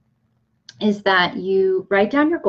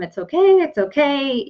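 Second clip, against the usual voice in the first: calm an upset client, starting high; comforting a crying client with gentle reassurance; reassuring; 7 semitones higher, 3 dB louder than usual. Retold or imitated. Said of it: "And I tell them".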